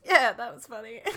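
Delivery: loud, amused mock quote; Tony impression. Imitating Tony answering the friend's teasing, loud and hammed-up. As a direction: punch the reply out loudly for comic effect. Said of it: "and Tony goes".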